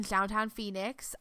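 ordinary talk

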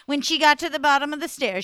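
usual speaking voice

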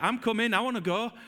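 impatient excitement, pitched high; eager Peter. Retold or imitated. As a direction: call out like you're already jumping from the boat. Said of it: "He's like".

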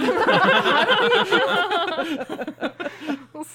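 long loud burst of laughter. Real, not acted. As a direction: crack up loudly. Big laugh after joke.

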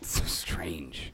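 whispered aside. Acted. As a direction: drop to a slow whisper. Mock-shocked aside about orphans.

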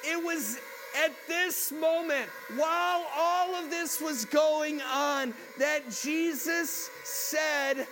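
loud, high, slow preaching over the noise; preaching at full force. Acted. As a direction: proclaim it slowly above the crowd.

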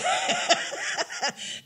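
real high-pitched laugh; airy high laughter. Real, not acted. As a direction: burst of giggling. Laughing at own story.

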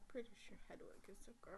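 quick, whispered aside; hushed, insistent interjection; murmured correction. Real, not acted. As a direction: mutter it fast under your breath. Repeats correction as host talks on.

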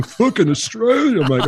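high, slow, eye-rolling sneer. Retold or imitated. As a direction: sneer it out with a big eye roll. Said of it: "eye-rolling, sneering".

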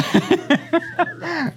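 real high burst of laughter; amused laughing burst. Real, not acted. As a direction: laugh at your own joke. Laughs at own joke.

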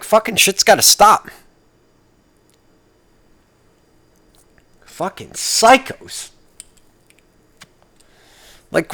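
heated, high, pushed-out rant; angry rant. Real, not acted. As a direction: vent at everyone who won't give up. Ranting at people, rising anger.

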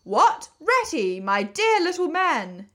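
high, loud, drawn-out astonished greeting; big surprised welcome, pitched high; exclaiming in delighted surprise. A narrator's reading. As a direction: greet an old friend with slow, hearty amazement. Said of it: "exclaimed the Badger, in quite a different voice".

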